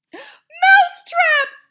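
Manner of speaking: high, slow, loud witch cry; witches crying out. A narrator's reading. Witches echoing their leader, slow loud high cry. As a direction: echo it back in a frenzy. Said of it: "cried the witches".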